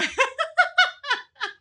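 high, bright burst of laughter. Real, not acted. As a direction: laugh at yourself. Laughing at memory.

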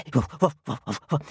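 short low woofs; dog barking. Acted. The doggy answering the fox's call, acted woofing. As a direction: woof like a storybook dog. Acting the doggy being called.

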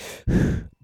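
long, audible exhale; heavy breath out. Retold or imitated. Acting out walking through the visionary mansion, breathing heavily. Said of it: "breathing really heavily".

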